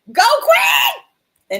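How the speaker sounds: loud, high whoop of encouragement; sudden high-pitched cheer; cheering shout. Real, not acted. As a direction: holler it like a proud hype woman. Cheering on someone in the chat.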